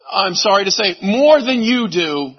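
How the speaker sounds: ordinary delivery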